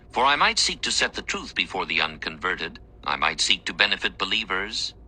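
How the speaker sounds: usual speaking voice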